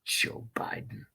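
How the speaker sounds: a breathy whisper of the name. Real, not acted. Streamer whispers the name to chat, as a whisper. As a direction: whisper it almost to yourself. Whispered aside after the song.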